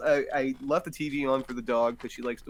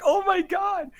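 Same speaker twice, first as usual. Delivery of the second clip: shocked; slower than usual. Retold or imitated. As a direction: blurt it out in disbelief. Shocked reaction retold.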